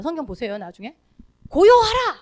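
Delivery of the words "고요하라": high, loud one-word order; short commanding shout; sharp single command. Shown as a demonstration. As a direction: snap it out once. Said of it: "한마디 합니다".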